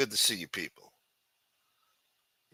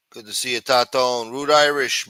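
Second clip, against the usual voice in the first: ordinary delivery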